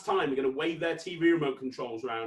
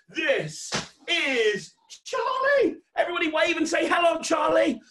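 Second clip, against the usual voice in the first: loud, high showman's reveal; big excited introduction; 11 semitones higher, 6 dB louder than usual. Real, not acted. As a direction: present the star to a cheering crowd. Presenting the puppet to the children.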